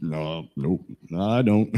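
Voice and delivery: low, soft sheepish denial; husband's denial. Retold or imitated. Voicing a man denying bad habits, low sheepish mumble. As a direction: play the caught husband, quiet and low. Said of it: "He's, he's".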